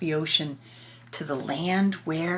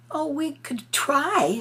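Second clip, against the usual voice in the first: put-on higher, slow plaintive girl; lightly voiced little girl; 8 semitones higher, slower than usual. Lucy telling Turtle they can't sleep, light plaintive girl voice. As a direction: say it like a sleepy girl asking for help.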